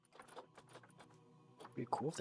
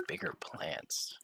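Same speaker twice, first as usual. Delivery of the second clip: normal talking voice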